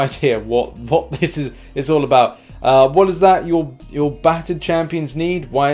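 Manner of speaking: ordinary talk, nothing marked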